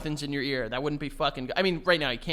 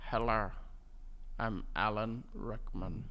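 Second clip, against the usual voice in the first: low, slow, hushed drawn-out impression; slow it right down, deep and quiet; Alan Rickman; 7 semitones lower, 11 dB quieter, slower than usual. Trying a film actor's voice for the co-host, low and slow. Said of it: "Alan Rickman's voice".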